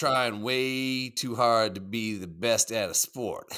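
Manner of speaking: put-on slow, drawled Southern accent reading a chat message. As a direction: read it out like a Southern guy. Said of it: "in an accent?".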